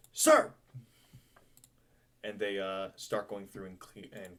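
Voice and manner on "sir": high, loud one-word reply; crew's salute. Acted. Crew answering the captain's order, high loud shout. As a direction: shout it back like a deckhand.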